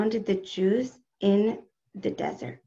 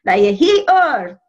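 loud, slow imitation Russian accent; 8 dB louder, slower than usual. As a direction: proclaim it like a feisty aunt with a Russian accent. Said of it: "she'd say in a little Russian accent".

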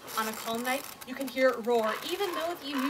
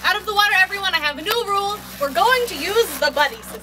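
loud, higher shouted order; calling out; 6 semitones higher, 13 dB louder than usual. Acted. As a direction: command the kids out of the water. Ordering kids out of water.

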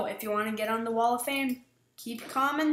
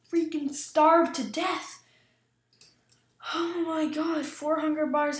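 panicked whine; 6 semitones higher, 6 dB louder than usual. Real, not acted. Starving in game, panicking.

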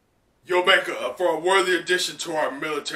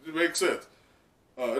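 ordinary talk

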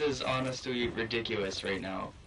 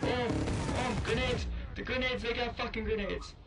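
panicked; 10 semitones higher than usual. Real, not acted. Enemies throwing grenades.

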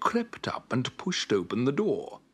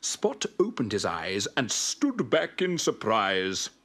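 ordinary delivery, nothing marked

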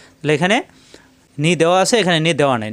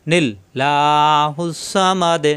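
1 Quran recitation; much slower than usual. Shown as a demonstration. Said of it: "নি মিলবে লামের সাথে".

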